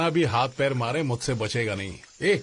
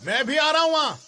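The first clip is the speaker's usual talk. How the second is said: high-pitched holler; calling out; 11 semitones higher, 4 dB louder than usual. Acted. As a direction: shout over that you are joining them. Hollers to a companion.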